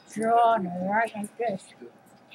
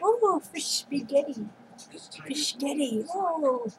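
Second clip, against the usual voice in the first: mock delighted; 5 semitones higher than usual.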